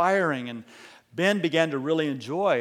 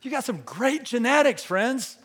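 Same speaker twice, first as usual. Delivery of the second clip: raised, ringing repeat; high, emphatic repeat; 10 semitones higher, 4 dB louder than usual. Shown as a demonstration. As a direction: lift the line high for the congregation. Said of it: "preacher repeats line for emphasis".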